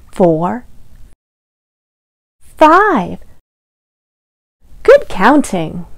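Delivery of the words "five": usual speaking voice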